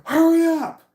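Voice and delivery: very high, loud scream; retold scream. Shriek it up high and loud. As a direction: parent screaming at kid to hurry to the bathroom. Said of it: "looks at me and screams".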